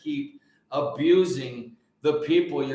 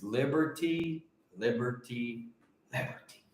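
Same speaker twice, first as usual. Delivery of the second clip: each liberty softer until whispered; fading out; 7 semitones lower, 8 dB quieter than usual. Real, not acted. Savouring the word liberty, fading softer each time. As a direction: let liberty fade to a breath.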